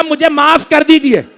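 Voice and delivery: high, loud keening plea; lamenting cry for forgiveness; wailing plea. Acted. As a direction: cry it out as a grieving sister begging pardon.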